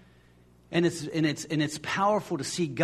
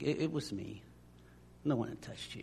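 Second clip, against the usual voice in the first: hushed confession; 5 semitones lower, 11 dB quieter than usual. Retold or imitated. Woman confesses to Jesus.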